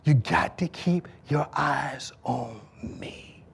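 soft, slow whisper; Jesus whispering a gentle reminder. Retold or imitated. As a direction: breathe it like a secret. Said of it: "Jesus saying to me".

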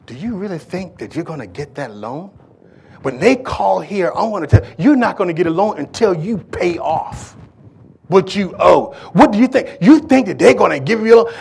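mock the rasping, angry lady on the phone; angry loan lady. Loan lady scolding the young husband, angry and rasping. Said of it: "her voice just being so rasping, so angry".

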